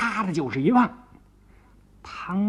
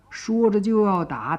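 ordinary delivery

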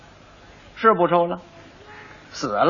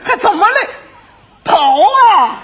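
high, loud panicked shout; blurted shout; 6 dB louder, slower than usual. Acted. Quack doctor losing his temper and shouting at the boy, panicked yell. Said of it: "他嚷嚷出来了".

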